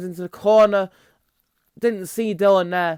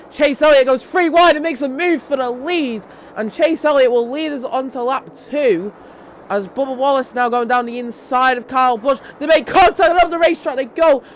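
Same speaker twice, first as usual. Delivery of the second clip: raised, louder play-by-play call; excited race commentary; 6 semitones higher, 5 dB louder than usual. Real, not acted. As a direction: build to a shout at the crash, then settle. Calls the race live.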